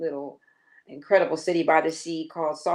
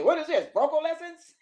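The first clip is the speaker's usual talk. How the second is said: impatient jab; 11 semitones higher, 3 dB louder than usual. Retold or imitated. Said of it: "Then I heard Sly say".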